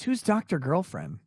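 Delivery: ordinary delivery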